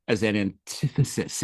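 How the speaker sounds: normal talking voice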